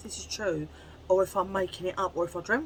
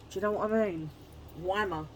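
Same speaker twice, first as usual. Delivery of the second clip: ordinary talk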